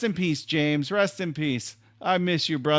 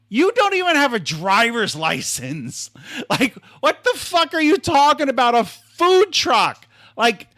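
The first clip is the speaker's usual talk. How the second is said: higher, louder mocking disbelief; incredulous; 6 semitones higher, 4 dB louder than usual. Scoffing at James's food truck idea, loud and incredulous. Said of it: "I literally said to him".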